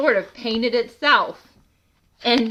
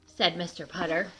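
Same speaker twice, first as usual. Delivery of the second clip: plain reading voice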